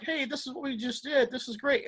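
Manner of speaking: high-pitched mock chatter of pleased walkers; imitated returning walkers. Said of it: "people that just came off the walk".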